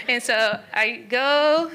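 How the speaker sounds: words slowed and carried on a laugh. Real, not acted. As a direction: laugh through the start of the sentence. Laughs at her own line.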